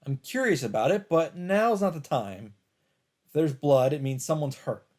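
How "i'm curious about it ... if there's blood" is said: ordinary talk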